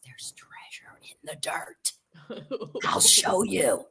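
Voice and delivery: slow, soft conspiratorial whisper; secretive whisper. Retold or imitated. Quincy telling grandmother about treasure, slow secret whisper. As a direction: lean in and share a secret. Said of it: "He leans forward and says".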